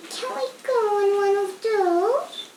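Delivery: a gently voiced little Yeti, asking shyly; Yeti's voice. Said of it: "he asked".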